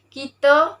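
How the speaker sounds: "kita" said as "kito"; Kelantan dialect pronunciation. Shown as a demonstration. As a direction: end "kita" on a rounded o. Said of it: "dialek Kelantan juga".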